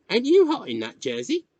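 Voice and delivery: put-on high, loud boy's question; curious country boy; village boy.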